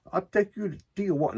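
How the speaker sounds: lightly put-on Mexican accent for the neighbor's offer. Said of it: "Now I'm doing accent".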